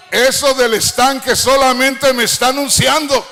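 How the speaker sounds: fervent, ringing sermon climax; full-force preaching. Real, not acted. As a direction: proclaim it to the crowd in one driving run.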